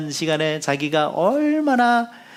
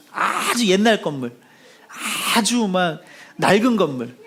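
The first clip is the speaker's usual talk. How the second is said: ordinary delivery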